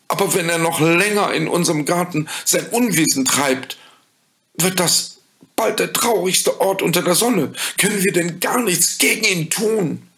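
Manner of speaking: put-on high, pleading child voice; worried children. A narrator's reading. Children begging the fairy for help, in a high worried voice.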